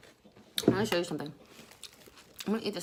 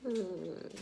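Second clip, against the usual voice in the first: usual speaking voice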